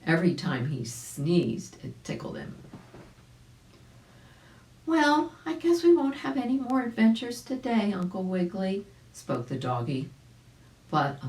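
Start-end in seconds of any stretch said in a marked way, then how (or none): 1.8-2.5 a hushed little ending; whispered. A narrator's reading. Narrator whispering the tickle to the child. 4.9-8.9 a content little dog sighing it's over. Acted. The dog telling Uncle Wiggily the adventures are done, higher voice.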